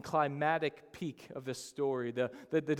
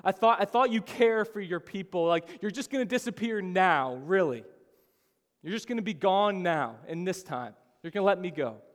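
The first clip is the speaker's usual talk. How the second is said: loud, higher, hurt accusation; fury at God; 4 semitones higher, 7 dB louder than usual. Acted. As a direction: accuse God of abandoning you. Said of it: "our responses are often fury over faith".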